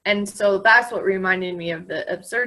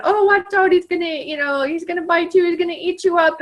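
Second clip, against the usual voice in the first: playful fake alarm, much higher and louder; mock warning; 9 semitones higher, 3 dB louder than usual. Teasing a girl afraid of dogs, playful mock warning. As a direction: pretend the dog is a monster, for fun. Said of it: "she starts laughing".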